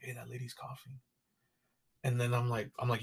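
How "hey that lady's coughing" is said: much softer, hushed aside; hushed warning. Retold or imitated. Girlfriend quietly warning about the coughing lady, hushed. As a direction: whisper it so the lady won't hear. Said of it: "She kinda went like".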